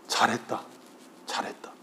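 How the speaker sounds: soft, fading comfort, down to a whisper; tender whisper. Retold or imitated. Father holding his son, whispering tender praise. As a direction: hush him gently like a father holding his child.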